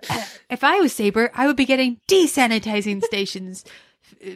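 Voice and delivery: put-on emphatic American accent; American accent, Dwight. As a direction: declare it firmly in an American accent. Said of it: "I put an American accent on".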